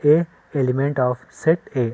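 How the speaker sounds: slow, drawn-out reading aloud; slow model reading. Shown as a demonstration. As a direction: show the class how it is read. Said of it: "या फिर ऐसे कर सकते हैं".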